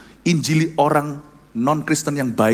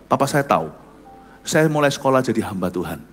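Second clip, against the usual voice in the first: ordinary delivery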